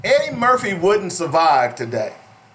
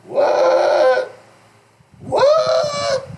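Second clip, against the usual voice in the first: shocked what. Real, not acted. Host reading the reparations headline aloud, shocked and high. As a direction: shoot up high in disbelief.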